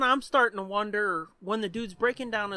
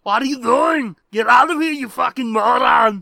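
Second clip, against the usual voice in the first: put-on loud shouted German accent insults; 11 dB louder than usual. Wife screaming at the stubborn guest, shouted German accent. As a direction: yell it in a German accent, furious. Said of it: "in a German accent".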